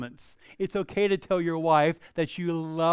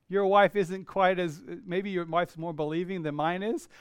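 ordinary talk, nothing marked

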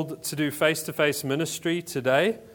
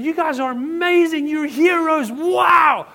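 high, loud, starry-eyed praise; hammed-up fan gushing over heroes; gushing admiration; 11 semitones higher, 9 dB louder, slower than usual. American shoppers praise the missionary pastors, loud gushing awe. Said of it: "this kind of pomp and ceremony".

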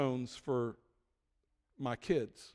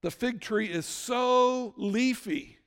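slow solemn; 6 semitones higher, much slower than usual. Acted. Slow solemn preaching line.

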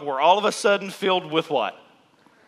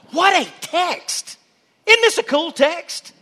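delighted exclaim; 13 semitones higher, 6 dB louder than usual. Real, not acted. Preacher thrilled by the Bible passage, exclaiming high. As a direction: marvel out loud at the passage.

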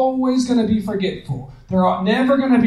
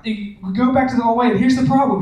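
normal talking voice

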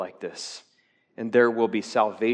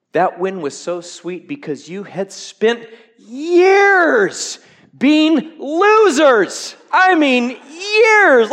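higher, louder and slow, stretching years; preaching at full force; 13 semitones higher, 11 dB louder, slower than usual. Acted. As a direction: hammer the long wait with a climbing shout. Preaching climax about years of losing.

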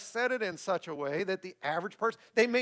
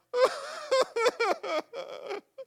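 mock sob-laugh. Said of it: "do they ever go".

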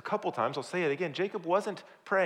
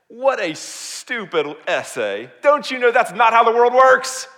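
hammed-up shouted reprimand, building louder and higher; scolding; 8 semitones higher, 12 dB louder, slower than usual. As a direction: bellow a mock telling-off at a child. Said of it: "go scold the seven-year-old".

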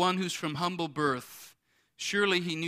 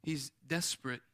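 usual speaking voice

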